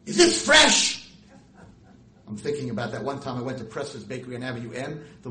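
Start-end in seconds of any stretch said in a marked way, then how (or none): 0.1-1.1 loud, high, slow mock of a demanding shopper; hammed-up cranky customer; grumpy lady. Lady barking at the Korean florist, loud mock voice. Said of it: "and they're like".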